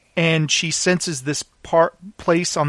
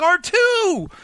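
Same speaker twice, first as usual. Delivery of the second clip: a much higher mock cry of joy; excited Luke; 14 semitones higher than usual. Fan imitates Luke spotting R2 with delighted high cry. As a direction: call out like a kid seeing an old friend. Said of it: "and he's like".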